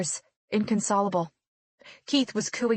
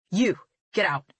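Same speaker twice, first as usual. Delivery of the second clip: curt order. A narrator's reading. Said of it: "he ordered me".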